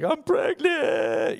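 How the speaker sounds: imitating a high, loud, slow sobbing wail; hammed-up tearful cry, high and loud; sobbing confession. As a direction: cry it out like the pregnant wife breaking down. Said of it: "she just starts to sob".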